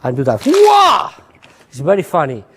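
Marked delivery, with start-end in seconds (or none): 0.4-1.1 mock startled cry. Imitating a startled spectator.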